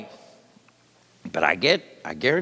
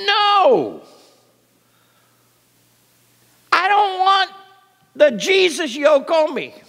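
whiny reluctant protest; 10 semitones higher, 4 dB louder than usual. Retold or imitated. Mocking reluctant churchgoers.

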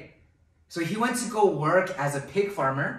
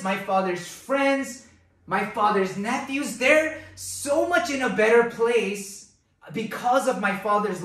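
longing realization; 2 semitones higher than usual. Retold or imitated. Son realizing his father's servants fare better, slow and earnest. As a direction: dwell on each phrase of longing. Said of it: "This son is saying".

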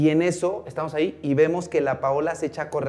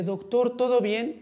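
higher, slowed friendly question; questioning greeting; 9 semitones higher, slower than usual. Retold or imitated. Roselena greets the doctor in the hospital hallway, questioning greeting. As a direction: voice a higher-pitched check-in.

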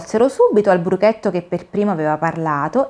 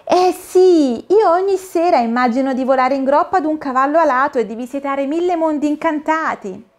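astonished exclamation; 7 semitones higher than usual. Acted. Dorina astonished, tells of her dreams. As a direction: blurt it out in wonder. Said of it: "sbalordita, esclamò".

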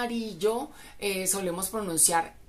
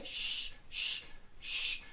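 airy, soft sh hush; 6 dB quieter, much slower than usual. Shown as a demonstration. Teacher shows the soft sh to students. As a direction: breathe out a gentle sh. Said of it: "es un sonido suave".

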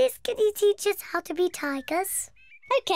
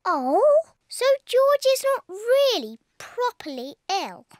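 ordinary delivery

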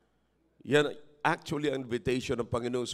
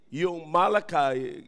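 usual speaking voice

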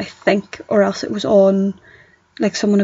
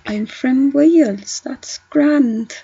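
slow, higher-pitched attempt at a Welsh accent; 4 semitones higher, much slower than usual. Shown as a demonstration. Showing a friend grand from Wales, Welsh accent. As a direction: try a drawn-out Welsh lilt. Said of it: "I can't do a Welsh accent".

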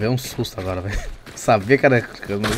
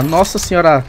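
ordinary delivery

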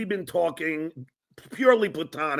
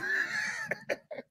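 very high shrieking cackle; host losing it at her laugh, squealing; 11 dB quieter than usual. Real, not acted. Host cracks up.

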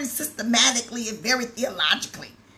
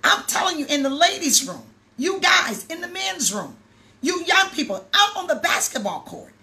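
louder, rising fervent exhortation; preaching at full force; 4 semitones higher, 3 dB louder than usual. Acted. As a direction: preach it out to the whole room.

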